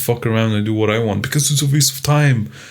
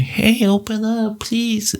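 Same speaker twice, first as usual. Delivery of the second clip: fairy voice; 12 semitones higher, slower than usual. Retold or imitated. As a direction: float it up high like a coaxing fairy. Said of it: "they meet a fairy".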